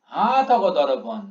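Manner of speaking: slow recitation with "হা" stretched long; drawn-out syllable. Shown as a demonstration. Teacher reciting the verse for students, "হা" long-drawn. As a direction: pull "হা" out in "হা থকো দর্পণ". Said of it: "হাটাকে কত দূর টানছি আমি".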